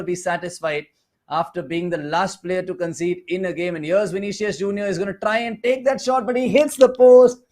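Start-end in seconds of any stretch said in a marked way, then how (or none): 3.7-7.4 excited play-by-play. Real, not acted. Commentator calling a shot that hits the post, excited. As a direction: build to a loud, high peak.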